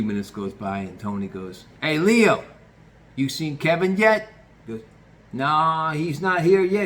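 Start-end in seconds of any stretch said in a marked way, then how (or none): 1.8-4.3 loud, higher call across the kitchen. Retold or imitated. Boss calling out to nephew. 5.3-6.9 raised, higher voice answering back; loud reply. Retold or imitated. Leo answering loudly.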